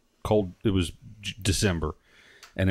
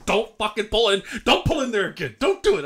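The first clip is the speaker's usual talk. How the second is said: pleading wife; 7 semitones higher than usual. Retold or imitated. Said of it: "She goes".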